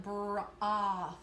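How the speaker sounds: broth drawn out sound by sound; stretched out. Shown as a demonstration. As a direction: stretch broth into slow br-oth. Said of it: "stretch that out".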